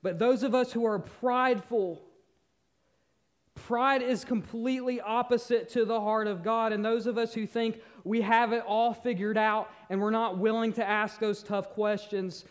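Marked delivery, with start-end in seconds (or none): none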